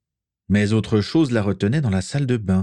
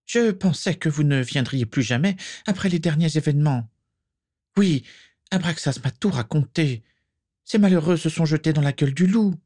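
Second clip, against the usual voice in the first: high, plaintive character voice; raised voice with a touch of lament; sorrowful portrait lady; 8 semitones higher than usual. Acted. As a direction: confide sad worries to a returning friend. Voices Prisca Malfoy's sad portrait.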